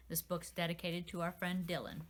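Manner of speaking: plain reading voice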